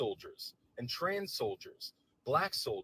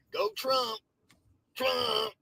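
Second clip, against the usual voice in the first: mock cheer; 9 semitones higher, 6 dB louder, much slower than usual. Mocking cheer.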